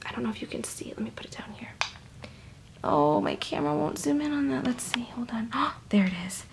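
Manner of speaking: hushed show-and-tell whisper. Real, not acted. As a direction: whisper while fiddling with the camera. Whispering while showing the jar.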